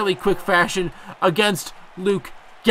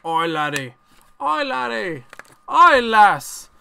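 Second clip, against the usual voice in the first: hammed-up Irish accent climbing much higher; badly faked Irish, drawn-out cries getting higher; attempted Irish accent; 5 semitones higher, much slower than usual. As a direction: do a cartoon leprechaun Irish accent. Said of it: "I hate doing the accents".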